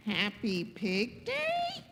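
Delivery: low, slow questioning echo; puzzled Gerald. Acted. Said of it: "And Gerald says".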